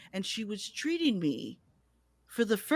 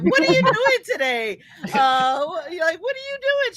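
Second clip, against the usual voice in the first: eager excitement; 10 semitones higher, 7 dB louder than usual. Retold or imitated. Eagerly calling grandma.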